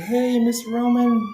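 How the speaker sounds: high, loud, drawn-out greeting; nurse calling out. Retold or imitated. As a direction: imitate a nurse rousing a patient. Nurse greeting waking patient, acted.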